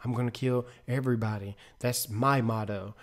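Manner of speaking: imitating the crazy prisoner's calm, soft threat; eerily calm. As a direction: say it quietly and matter-of-fact, like a calm madman. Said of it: "real calm and was like".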